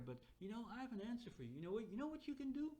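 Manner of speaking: high, rising enthusiasm; eager helper. Acted. AI brightly offering an answer.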